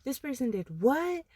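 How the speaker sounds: high, mock-shocked question; mock shocked gossip. Imitating a nosy friend, mock shocked. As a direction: act out a nosy listener's gasp.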